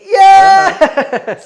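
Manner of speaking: loud, high victory cry; triumphant shout. Real, not acted. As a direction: yell out the win. Dice roll succeeds.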